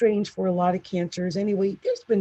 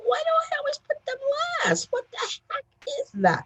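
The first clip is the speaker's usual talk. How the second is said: much higher, faster mock whine at her own habit; exasperated at herself. Scolding herself for putting Libra last, high mock whine. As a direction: whine it like catching yourself again. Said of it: "And I'm like".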